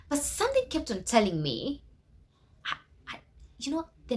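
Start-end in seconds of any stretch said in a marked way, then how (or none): none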